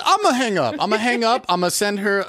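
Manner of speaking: high-pitched backing out; hammed-up timid retreat; mock nervous caller. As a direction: back out of the call in a high, meek voice.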